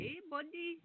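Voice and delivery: high, soft mock call from a car; stranger calling out. Stranger calls from car, mock high call. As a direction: sweetly lure him over.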